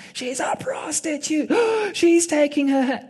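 high-pitched shocked stage gossip; scandalised onlookers. Retold or imitated. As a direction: gasp it out as an appalled crowd, high-pitched. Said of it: "and everyone's like".

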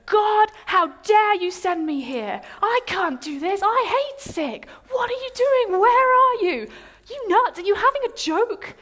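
high, loud outraged rant; raging tantrum. Retold or imitated. Retelling her tantrum against God, raging. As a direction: hurl furious questions upward in a re-enacted fit. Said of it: "I was raging. I was going".

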